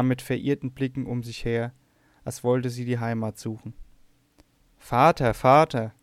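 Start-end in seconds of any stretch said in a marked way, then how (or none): 4.8-5.3 loud anguished cry of Vater, held open; desperate cry for her father; piercing wail. A narrator's reading. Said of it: "rief sie mit durchdringendem Wehelaut". 5.3-5.9 loud anguished Vater, falling away at the end; a wailing cry that dies down; piercing wail. A narrator's reading. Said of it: "rief sie mit durchdringendem Wehelaut".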